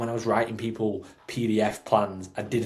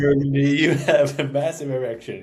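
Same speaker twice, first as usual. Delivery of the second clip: movie quote; 5 semitones higher, 7 dB louder than usual. Retold or imitated. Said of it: "the scene in Anchorman where she's like".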